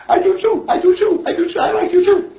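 put-on very high, loud, laughing child; delighted little boy. Brother Timmy answers his sister, delighted and squealing. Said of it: "And he said".

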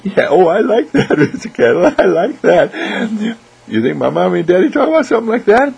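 put-on high, loud, giggly little kid; light up with delight in a child's voice; excited child. Child hears the teddy bear idea, excited.

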